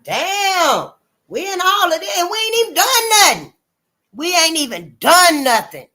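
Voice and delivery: loud, high-pitched disbelief; indignant outcry. Acted. Speaking for a people caught in a mess, indignant. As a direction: protest innocence, voice jumping up high.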